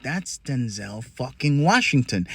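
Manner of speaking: slow, soft, stunned under the breath; awed hush. Retold or imitated. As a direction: say it low like you can't believe your eyes. Stunned recognizing star in elevator.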